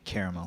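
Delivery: "caramel" with three syllables, CARE-uh-mel. Shown as a demonstration. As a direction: say "caramel" with an "air" first vowel. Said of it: "I, I say".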